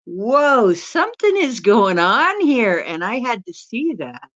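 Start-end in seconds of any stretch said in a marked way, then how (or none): 0.0-2.8 mock amazement. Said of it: "It's like".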